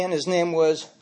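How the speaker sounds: usual speaking voice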